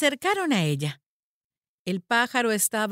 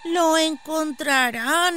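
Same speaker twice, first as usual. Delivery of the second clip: put-on high, slow child's voice; a little kid haltingly giving directions; high-pitched, drawn-out child voice; village child; 7 semitones higher, 4 dB louder, slower than usual. A narrator's reading.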